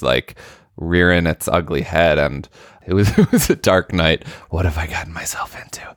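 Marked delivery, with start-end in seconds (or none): 4.5-5.8 fast unvoiced whisper of panic; panicked whisper. Sleepless speaker reliving late-night panic, fast whisper. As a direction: hiss it fast under your breath.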